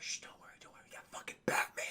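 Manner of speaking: hushed whisper. Retold or imitated. Kid whispering to his friends that Batman is here, hushed whisper. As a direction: whisper to reassure the friends, a bit fast.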